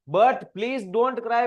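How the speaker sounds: very slow, stretched reading of the line; dramatic slow verse reading; slow poem recitation. Shown as a demonstration. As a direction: draw out every word like verse.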